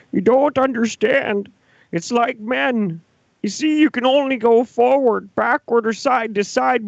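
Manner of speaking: very high, tearful whining mockery; hammed-up cry-fest voice, pleading through mock tears; Jordan Peterson crying. Mocking a professor weeping over a chess piece, crying impression. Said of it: "Jordan Peterson having just a filthy, filthy bitch boy fucking cry fest".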